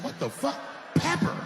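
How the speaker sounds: high incredulous squeak on Pepper; rising disbelief, voice jumping up; shocked disbelief. Retold or imitated. Shocked reaction to the gun seller.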